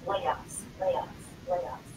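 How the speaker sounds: low, hushed rumor going round; quietly repeated murmur of gossip; imitated office buzz. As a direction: voice the layoff buzz, low and soft. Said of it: "you hear this buzz".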